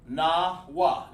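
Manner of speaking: NAWA as two slow, separate beats, na, wa; broken into syllables. Shown as a demonstration. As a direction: say "Nawa" slowly with a gap after Na. Demonstrates the word syllable by syllable.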